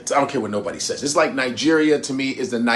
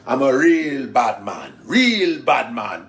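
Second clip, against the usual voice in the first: mock Jamaican bad man; slower than usual. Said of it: "I'm an official Jamaican".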